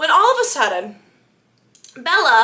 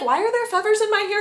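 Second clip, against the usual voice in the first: higher, fast, ditzy puzzlement; puzzled Bella; 9 semitones higher, faster than usual. Retold or imitated. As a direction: rattle it off wide-eyed.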